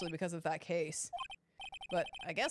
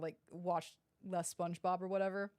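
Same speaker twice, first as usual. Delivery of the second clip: ordinary delivery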